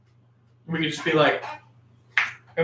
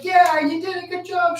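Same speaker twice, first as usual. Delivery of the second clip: put-on little girl cheer; 14 semitones higher than usual. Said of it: "she would get a goal and be like".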